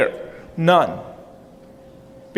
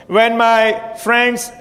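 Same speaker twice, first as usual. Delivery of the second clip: normal talking voice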